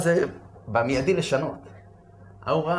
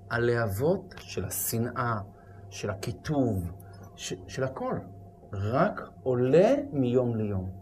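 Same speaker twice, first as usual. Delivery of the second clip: slow, much softer lament; grave hush; 5 semitones lower, 6 dB quieter, slower than usual. Real, not acted. Preacher warning the audience about spreading hatred, slow soft gravity. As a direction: let it sink in, slowly and softly.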